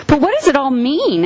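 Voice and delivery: put-on eager child's question. A narrator's reading. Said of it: "asked Susan".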